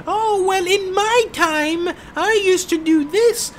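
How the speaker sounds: put-on very high-pitched, slow caricature of an old lady; old lady impersonation. Shown as a demonstration. Gamer showing how some old ladies sound, squeaky-high old lady. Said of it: "some old ladies that sounds like".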